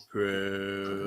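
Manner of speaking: low, eerie demon call. Retold or imitated. Host imitating demon Shax calling Prue, low. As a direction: growl the name like Shax. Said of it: "Did he do the voice?".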